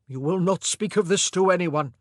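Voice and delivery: loud, stern command. A narrator's reading. King ordering the women to silence, loud command. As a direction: lay down the order. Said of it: "he commanded".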